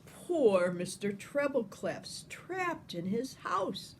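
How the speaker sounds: ordinary delivery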